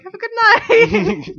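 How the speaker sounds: a light, amused goodbye; lightly voiced German guy. German tourist leaving after lending his phone, amused goodbye.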